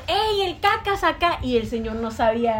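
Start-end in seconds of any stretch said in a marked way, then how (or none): 0.1-1.4 high, drawn-out mock shout; retold call-out. Friend hails the dad's acquaintance by nickname, mock shout. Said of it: "Y le gritó".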